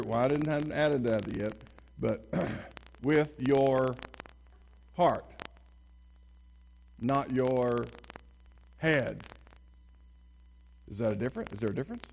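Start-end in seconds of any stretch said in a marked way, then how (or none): 3.0-9.2 louder contrast of "heart" and "head"; stress on heart and head. Shown as a demonstration. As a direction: land hard on "heart" then "head". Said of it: "emphasis on each word".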